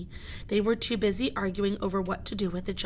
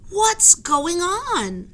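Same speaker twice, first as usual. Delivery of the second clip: calling out; 10 semitones higher, 7 dB louder, much slower than usual. Acted. Annie calling across deck.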